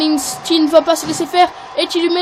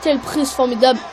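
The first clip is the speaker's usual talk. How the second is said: wrestling commentator. Acted. Play-by-play of the match.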